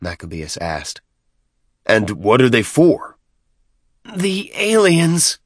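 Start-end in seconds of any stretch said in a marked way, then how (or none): 1.9-3.2 probing question. A narrator's reading. Chieftain questions the new vehicles. 4.1-5.4 high, slow, drawn-out wail. A narrator's reading. Deacon groveling at the chieftain's feet, wailed. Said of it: "the deacon wailed".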